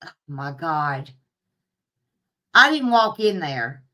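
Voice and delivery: ordinary talk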